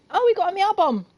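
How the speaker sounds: high, loud, excited call-out; excited shout. Real, not acted. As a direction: squeal the news out loud. Excited call-out over game event.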